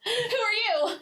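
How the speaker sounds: high-pitched cheeky introduction; playful mock-sweet sarcasm; sarcastic, funny comeback. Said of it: "she was fucking sarcastic and funny".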